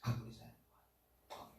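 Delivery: whispered. Shown as a demonstration. Pastor leads the church in a self-affirmation, whispered. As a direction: whisper "Aku disayang Tuhan" with no voice. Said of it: "berkatalah-katalah kepada dirimu".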